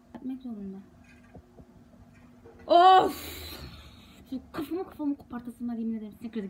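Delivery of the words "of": loud, high frustrated of; loud exasperated groan. Real, not acted. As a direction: let out an angry groan. (sinirli, yüksek sesli of)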